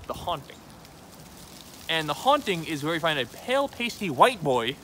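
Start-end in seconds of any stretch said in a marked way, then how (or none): none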